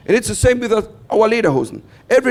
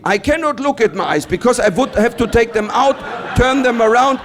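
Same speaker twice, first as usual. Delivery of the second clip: ordinary talk, nothing marked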